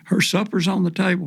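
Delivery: imitating a mom hollering dinner is ready; mock yell from the porch. Daughter calling off the back porch, mild mock yell. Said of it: "screaming off the back porch".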